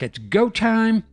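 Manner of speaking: a hammed-up battle cry; hyped Bigfoot. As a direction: Bigfoot squaring up to the bear, slow and high. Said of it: "Bigfoot's like".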